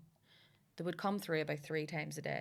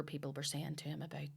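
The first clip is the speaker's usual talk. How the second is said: usual speaking voice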